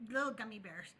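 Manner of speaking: ordinary delivery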